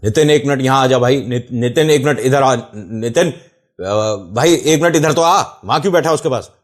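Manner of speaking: loud, high, urgent calls for his friend; retold panicked yelling. Scared friend yelling for Nitin to come over, loud and urgent. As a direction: shout across the corridor to get him over fast. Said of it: "तेज तेज चिल्लाने लग गया".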